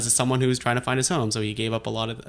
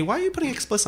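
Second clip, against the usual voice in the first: much higher, wide-eyed questioning voice; imitating Laura; 14 semitones higher, much faster than usual. Host retells his partner questioning the explicit tag, in a high mimicking voice. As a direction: ask it the way Laura asked. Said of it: "Laura asked me".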